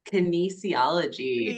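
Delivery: slow, careful enunciation; nice and slow. Shown as a demonstration. Guest repeating the word for host's friend, slowly. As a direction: spell it out slowly for a doubter. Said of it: "nice and slow".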